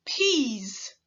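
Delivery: "peas." ending in a voiced z; teacher stretching the final z; final buzz. Shown as a demonstration. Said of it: "Repeat after me".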